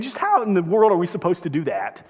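usual speaking voice

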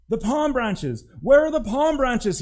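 high, loud, outraged shout; indignant donkey shouting at the marketplace. Acted. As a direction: yell for the branches like you were robbed. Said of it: "he shouted".

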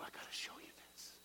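fast, secretive whisper. Acted. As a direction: hush it like sharing a secret. Acted friend leading, whispered.

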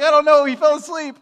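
high, loud helpless excuse; defensive protest. Retold or imitated. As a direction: plead it loudly in self-defence. Defending himself to dad.

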